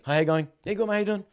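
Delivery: high, bright friendly hello to a stranger; cheery greeting. Retold or imitated. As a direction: chirp it out, overly friendly.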